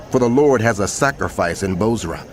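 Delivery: usual speaking voice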